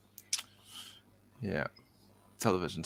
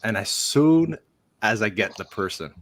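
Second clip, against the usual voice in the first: ordinary talk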